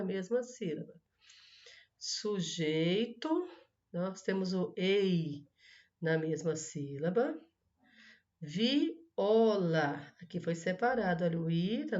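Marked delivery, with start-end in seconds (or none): 2.0-3.5 "Sujeito" drawn out as su-jei-to; syllable by syllable. Shown as a demonstration. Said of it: "separe-as em sílabas". 8.4-10.1 "Viola" split as vi-o-la; syllable by syllable. Shown as a demonstration. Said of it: "separe-as em sílabas".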